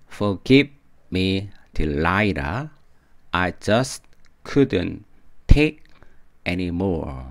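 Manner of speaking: very slow, separated phrases, take punched loud; slow model reading. Shown as a demonstration. Teacher repeating the corrected lyric for learners, slow and separated. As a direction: spell out the lyric word by word.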